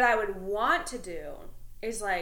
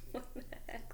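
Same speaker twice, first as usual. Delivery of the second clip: soft unvoiced whisper; whispered what the heck; 3 semitones higher, 12 dB quieter than usual. Shoppers whispering to each other in line, hushed. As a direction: whisper it to your neighbour. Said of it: "everyone's kinda like".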